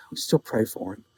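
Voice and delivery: low, soft, resigned regret; sigh, then say it softly like a family shame; imitated grandfather's hushed lament; mock sorrow. Mock sorrow over Arkansas State grandson.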